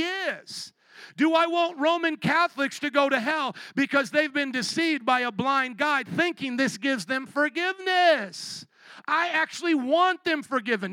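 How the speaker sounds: high, loud pleading preacher cry; fiery preaching. Real, not acted. Preacher pleading with the church about the Pope. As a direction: press the point hard from the pulpit.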